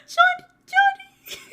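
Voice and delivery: high, loud happy calling; excited shout. Real, not acted. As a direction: call out his name with joy.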